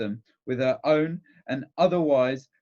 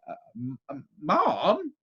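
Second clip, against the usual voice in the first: faltering start, then a high "ma'am"; flustered stammer; 3 semitones higher, slower than usual. A narrator's reading. Doctor cowed by the aunt's demand, stammering nervously. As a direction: stumble nervously into the word.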